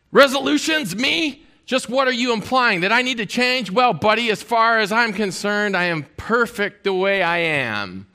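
high-pitched affronted cartoon guy, easing down at the end; mock indignation. Pastor voices cartoon guy refusing to change, mock indignation. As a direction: act offended and self-satisfied, starting high.

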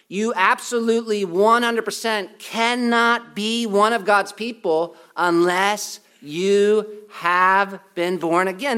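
slow, weighty preacher's insistence; emphatic insistence. Real, not acted. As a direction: hammer it home slowly, word by word. Preacher hammering home the essential requirement.

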